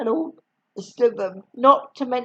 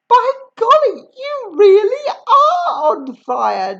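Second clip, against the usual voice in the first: amazed George; 11 semitones higher, 7 dB louder than usual. A narrator's reading. George seeing smoke from Grandma, higher amazed voice. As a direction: marvel at the smoke. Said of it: "George said".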